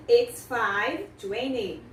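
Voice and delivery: "it's five twenty" stretched out very slowly; slow model answer. Shown as a demonstration. Teacher slowly models the answer "it's five twenty". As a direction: spell out each word for the students. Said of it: "Você fala".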